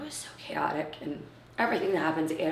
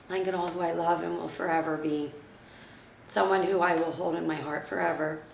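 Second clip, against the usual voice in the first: ordinary talk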